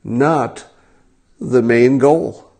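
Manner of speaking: "not" set apart by a pause, "the main goal" slow; stress on NOT. Retold or imitated. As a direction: read "not" as if in capitals. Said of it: "in capitals".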